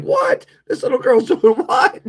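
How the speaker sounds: high-pitched, incredulous yelp; mock disbelief. Host retelling a misunderstanding about a young viewer, mock disbelief. Retelling own shocked reaction, laughing.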